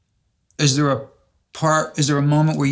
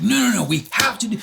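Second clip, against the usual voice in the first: higher, insistent no no no; insistent husband; 8 semitones higher than usual. Retold or imitated. Host voicing Terry refusing to take no, higher and insistent. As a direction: push back like a husband who won't budge. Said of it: "And Terry's like".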